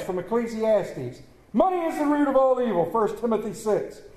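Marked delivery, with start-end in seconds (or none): 1.5-2.9 popular misquote. Retold or imitated. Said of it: "They're not in that Bible".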